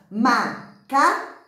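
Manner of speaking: MACACO broken into ma, ca, co with pauses; slow, separated MA - CA - CO; syllable by syllable. Shown as a demonstration. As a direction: count out MACACO slowly, one syllable per mouth opening. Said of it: "Quantas vezes você abre a boca".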